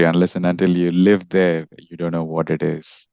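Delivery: ordinary delivery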